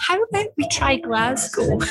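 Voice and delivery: bright high suggestion; excited. Real, not acted. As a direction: pitch it up with excitement.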